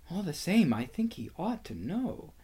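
high voice, softening at the end; insistent girl. A narrator's reading. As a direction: hold your ground in a small, high voice. Lucy insists to Susan quietly.

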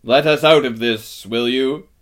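loud, projected request; commanding request. A narrator's reading. Aslan asks the giant to free them, loud command. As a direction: project it upward as a firm order.

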